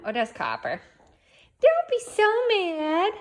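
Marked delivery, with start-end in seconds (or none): 1.6-3.1 high pet-talk soothing. Real, not acted. Owner soothing her grumpy dog in baby talk.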